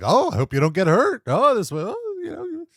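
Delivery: sugary fake concern, a hint of a smirk; lightly voiced fake-nice boss wishing luck; fake nice guy. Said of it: "He's playing, like, this nice guy".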